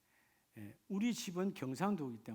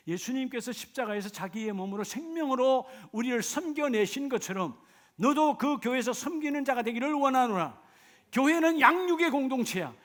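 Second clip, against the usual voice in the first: loud, high exhortation; full-force preaching; 11 semitones higher, 11 dB louder than usual. Acted. Preacher exhorting the congregation to hear the word, loud and high. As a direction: thunder God's call to the hall.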